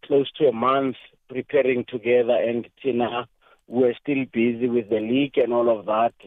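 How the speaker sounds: ordinary talk, nothing marked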